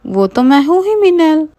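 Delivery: high, bright boast; proud friend. Acted. Rinki boasting to Meenal, high and proud. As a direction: preen a little. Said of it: "Rinki कहती है".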